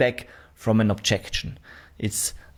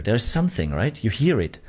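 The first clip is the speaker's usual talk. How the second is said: normal talking voice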